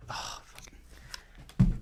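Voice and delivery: hushed whisper; whispered curse. Real, not acted. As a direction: breathe the curse out slowly. Aside under breath.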